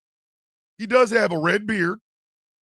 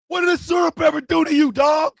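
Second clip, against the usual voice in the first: incredulous shout; 6 semitones higher, 3 dB louder than usual. Real, not acted. Host mocks the growler loudly.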